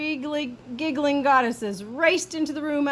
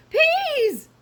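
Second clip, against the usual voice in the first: very high, loud squeal of peas; screamed; 6 dB louder than usual. A narrator's reading. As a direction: scream it as he wakes. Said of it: "screamed El Scipito".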